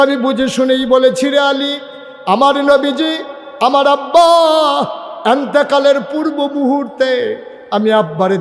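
high, chanted cry of grief; preacher voicing a grieving daughter, high and pleading; plaintive lament. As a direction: call out mournfully about a beloved father. Fatema answers Ali, recalling father.

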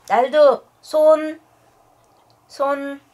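loud, high command; firm dog command. Real, not acted. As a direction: bark the command. (크고 높은 명령)

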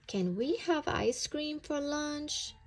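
high, eager little bunny asking; little bunny pleading for ice cream; young bunny voice. A narrator's reading. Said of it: "P J asked Pot's mom".

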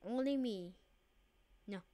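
ordinary talk

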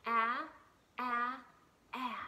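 short a sound, said three times; letter A short sound. Shown as a demonstration. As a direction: say a, a, a like in apple. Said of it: "The sound is, ready?".

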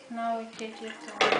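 ordinary delivery

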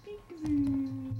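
slow, sing-song peekaboo call; drawn-out peekaboo. Real, not acted. Playing peekaboo with the baby.

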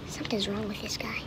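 low, hushed character voice; Batman. Acted. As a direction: drop it low and very quiet. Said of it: "And Batman's like".

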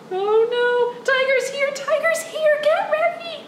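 high, excited flurry of alarm; mock panic. Storyteller warning children tiger has come, high mock panic. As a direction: squeal in playful panic.

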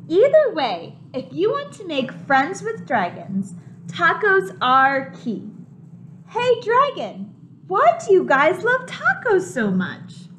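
animated picture book voice; reading to children. A narrator's reading. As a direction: make it exciting for the kids.

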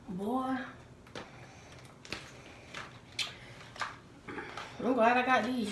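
talking through face mask; sheet mask on face. Real, not acted. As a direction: stiff lips under mask. Seen 3: Wet sheet mask covers face throughout; lips exposed through mouth hole.

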